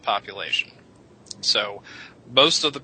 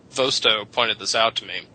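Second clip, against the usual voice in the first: normal talking voice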